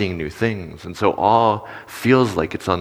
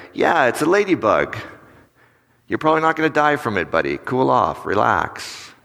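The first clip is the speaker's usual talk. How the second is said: higher-pitched, lightly humouring adult; animated but still dismissive reassurance; slightly better response; 3 semitones higher than usual. Acted. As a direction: play along briefly, then brush it off. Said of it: "might have got a little bit better".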